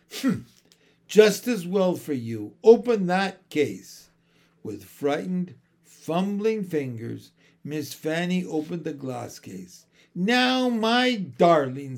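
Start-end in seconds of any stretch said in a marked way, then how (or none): 0.1-4.1 a hint of a sharp old woman, louder; stern old mother. A narrator's reading. Missus Corey ordering her daughter, sharp and stern. 10.2-12.0 put-on sugary, coaxing old woman, slow on darling; sweet different voice. A narrator's reading. Missus Corey turning sweet to the children. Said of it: "in quite a different voice".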